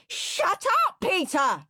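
put-on high, loud childish scream. A narrator's reading. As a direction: scream at the brother. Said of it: "screamed Henry".

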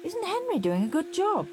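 high, bright-eyed mum, lightly voiced; a pleased mother marvelling at her son; Mum's voice. A narrator's reading. Mum praising Henry to Dad, in a lightly voiced high mum voice. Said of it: "said Mum".

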